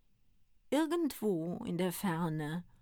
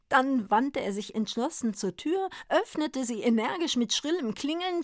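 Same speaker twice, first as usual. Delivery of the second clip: ordinary delivery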